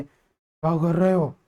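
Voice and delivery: put-on slow, heavy, threatening boy's voice; mock scary deep-voiced boy; gruff thick voice. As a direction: growl it slowly to scare them. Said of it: "इतना मोटी आवाज में बोल दें".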